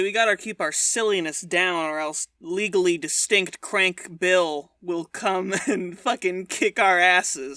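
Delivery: low, deep serious voice. Acted. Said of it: "we'll be regular boys".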